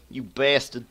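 indignant outburst. Real, not acted. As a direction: curse the opponent loudly. Cursing opponent, second take escalates.

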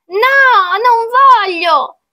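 put-on high, loud child protesting; child's refusal. Child refusing to become a turkey, high loud protest. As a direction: wail it high like a stubborn kid.

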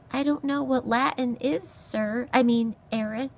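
high little mouse voice; timid mouse. Acted. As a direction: explain politely in a high mouse voice. Mouse answers beast meekly.

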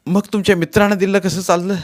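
irritated retort. A narrator's reading. Wife challenging husband's double standard, irritated. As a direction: throw it back at him. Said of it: "Sulu चिडून म्हणाली".

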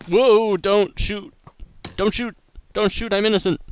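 high, loud mock panic; panicked yelling. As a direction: shriek in fake terror. Mock panic under gunfire.

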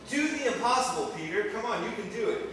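high-pitched acted encouragement; urging on. Voicing the Lord urging Peter on.

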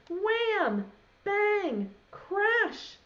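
high punchy sound words; crash sounds. Acted. Reading to children, punchy crash words. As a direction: bang out each crash. Things tumbling out.

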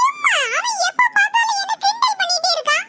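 put-on high childish protest; whining complaint. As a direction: complain to mother like a wronged child. Scolded child complains to mother. (சிணுங்கலான புகார்)

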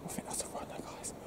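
scared hushed whisper; nervous night vlog whisper. Real, not acted. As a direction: whisper so nothing hears you. Hearing something, whispering.

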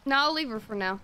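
ordinary talk

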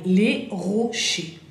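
"les rochers" said very slowly, with a French R and no final R; teacher model of "les rochers", ending on a clean E; slow French "les rochers" for students to copy; silent final letters. Shown as a demonstration. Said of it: "R, dijimos que era E".

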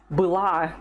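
была with the stress on the final A; stress on the last syllable. Shown as a demonstration. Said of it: "Pay attention to the stress".

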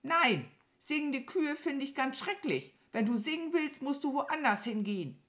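hoch und verärgert; knurrige Krähe. A narrator's reading. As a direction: mit hoher Stimme abwimmeln. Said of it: "knurrte die Krähe". (verärgerte, hohe Krähenstimme)

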